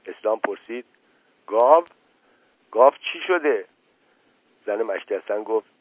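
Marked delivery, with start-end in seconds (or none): 1.5-3.6 sharp, louder, rising alarm; jolted into asking; surprised question. Acted. Eslam hears the cow news, startled and louder.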